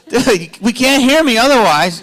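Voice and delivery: high, loud whine to the crew; hammed-up exasperated holler; mock complaint. Mock complaint at sound guy.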